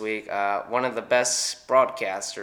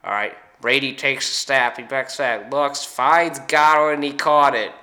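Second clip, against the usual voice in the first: louder play-by-play call, very slow on the catch; Joe Buck impression; 3 semitones higher, 8 dB louder than usual. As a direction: call the play like an NFL announcer. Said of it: "I got Joe Buck, Troy Aikman doing the N F L on Fox".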